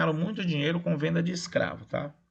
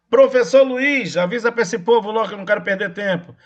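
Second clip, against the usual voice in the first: raised, higher-pitched call to a colleague; louder and higher, pressing for an answer; 5 semitones higher, 7 dB louder than usual. Real, not acted. Teacher calls on a colleague to answer for the class, calling out.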